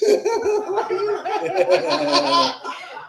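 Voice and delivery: long, high laughter; laughing fit. Real, not acted. Comic losing it at his own bit, high laughing fit. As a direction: crack up helplessly mid-bit.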